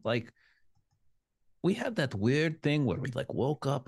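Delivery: ordinary talk, nothing marked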